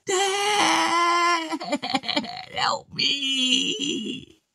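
very high desperate shout; screaming for help. Acted. Ivan screaming for his dad, very high. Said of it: "he screamed".

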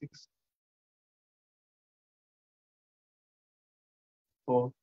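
slow, drawn-out countdown with long silences; guided meditation. Acted. Count listeners down into deep relaxation, guided meditation.

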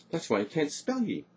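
high, elated realisation; joyful shout. A narrator's reading. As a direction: exclaim it with joy. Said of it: "shouted Morgan, leaping up with joy".

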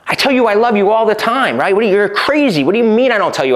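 mock-defensive boyfriend voice; defensive reacting. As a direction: push back like he's been wrongly accused. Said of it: "that's reacting".

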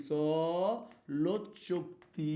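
চলৎ Shakti dragged out very slowly; slow dictation. Shown as a demonstration. Teacher dictating to the class, very slow. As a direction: spell out চলৎ Shakti for writing along. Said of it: "সবাই একবার লেখতো".

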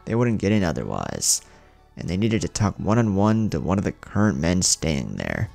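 ordinary delivery, nothing marked

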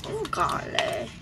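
high unvoiced gasp of awe; breathy mock wonder. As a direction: gasp it like a cartoon.

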